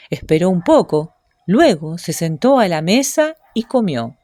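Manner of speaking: ordinary delivery, nothing marked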